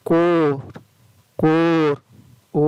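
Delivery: कोर with a drawn-out, steady ओ vowel; long O. Shown as a demonstration. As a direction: stretch the ओ in कोर into a long, held "oh". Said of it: "ओ लंबा पड़ा जाता है".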